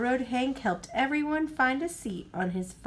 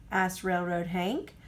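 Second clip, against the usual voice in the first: plain reading voice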